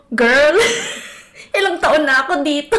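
mock returnee. Mocking a Filipina who claims she forgot Tagalog, loud. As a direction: play a Filipina showing off her years abroad. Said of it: "by accent pa lang ako".

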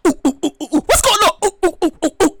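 monkey-like ooh ooh hoots; comic hooting noises; mock hooting. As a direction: hoot like a confused ape.

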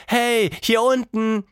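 high, loud, drawn-out call; slow, high holler upward. A narrator's reading. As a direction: shout up to get someone's attention. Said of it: "rief Tilda".

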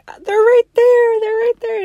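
high, loud called-out insistence; high calling out. Retold or imitated. Pointing husband to her chanclas, calling out loud and high. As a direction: call across the park to him.

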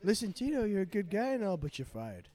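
high put-on presidential voice dropping at the end; Donald Trump. President firing a good guy, impersonated. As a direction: impersonate the boss firing someone. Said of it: "Donald Trump".

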